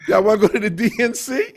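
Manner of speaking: fast, high and loud excited question; repeating the invite to his crew, excited and fast. Retold or imitated. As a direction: rush it out, thrilled. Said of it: "I was like".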